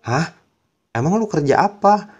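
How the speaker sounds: jolted disbelief at the news; surprised. Retold or imitated. Shocked the friend works.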